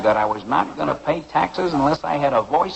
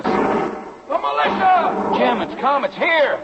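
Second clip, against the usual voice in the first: much higher, slow cry of alarm; excited cry; 10 semitones higher, slower than usual. Acted. Prisoner cries out to his lawyer as the militia arrives, excited. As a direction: shout the news that help has come.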